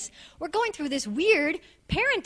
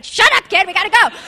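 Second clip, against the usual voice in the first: mock snap at kid; 4 semitones higher, 8 dB louder than usual.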